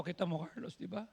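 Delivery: ordinary talk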